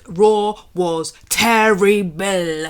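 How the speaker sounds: a bad French accent on purpose, stretched out; a botched French accent stretched slow for a joke; failed French accent. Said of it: "I can't even do a French accent".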